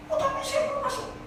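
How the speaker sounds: high, insistent plea; a stubborn newcomer begging at the gate. Acted.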